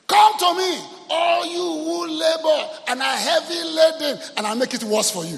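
high, loud, slow mocking recital; mock misquote. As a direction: declaim the twisted verse with irony. Mocking misquoted Bible verse.

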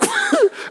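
short high giggle; quick, high amused laugh; chuckle. Real, not acted. Preacher joking about church groups, chuckling.

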